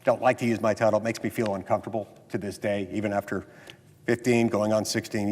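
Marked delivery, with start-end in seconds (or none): none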